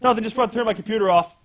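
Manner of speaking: high, rushed cover-up; hurried excuse. Retold or imitated. John covering when Lois busts in, acted hurried excuse. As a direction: blurt out an excuse as a caught kid. Boy caught, covering to his mother.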